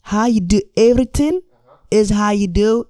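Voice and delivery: high, loud Habesha accent, hammed up. Shown as a demonstration. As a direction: Habesha accent at full volume. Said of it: "in my Habesha accent".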